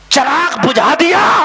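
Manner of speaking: high, loud, drawn-out proclamation; ringing preacher's shout; dramatic shout. Real, not acted. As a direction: cry it out slowly to the crowd. Preacher shouts the story's climax.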